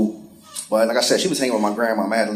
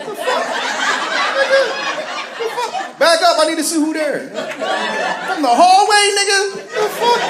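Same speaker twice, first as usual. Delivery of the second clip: pitched way up in mock shock; shocked yelp; high incredulous disbelief; 11 semitones higher than usual. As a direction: squeal it like you can't believe it. His incredulous reaction.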